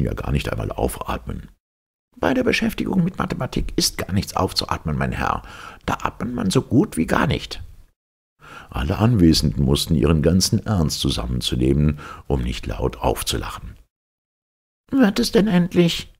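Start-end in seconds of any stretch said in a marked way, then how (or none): 2.2-7.6 high, exacting professor voice; pedantic professor. Professor quizzing his former pupil, high and impatient. 14.9-16.1 high, exacting professor voice; pedantic professor. Professor quizzing his former pupil, high and impatient. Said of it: "fragte der Professor".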